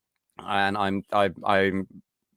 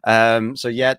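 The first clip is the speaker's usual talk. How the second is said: ordinary talk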